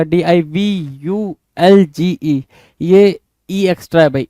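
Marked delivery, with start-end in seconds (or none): 0.0-2.4 letter by letter, very slow; slow spelling. Shown as a demonstration. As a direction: spell out the wrong letters slowly for the class. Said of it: "यहाँ पे क्या किया?". (धीरे-धीरे अक्षर बोलना)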